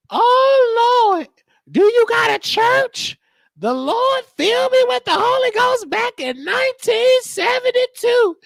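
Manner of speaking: hammed-up grandma witnessing at the car lot; church grandma testifying. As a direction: testify to the salesman like an old church lady.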